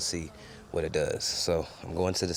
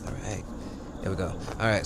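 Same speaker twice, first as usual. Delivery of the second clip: usual speaking voice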